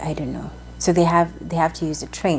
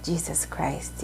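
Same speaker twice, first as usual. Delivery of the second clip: exasperated mutter; 10 dB quieter, much slower than usual. Retold or imitated. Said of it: "I was just like".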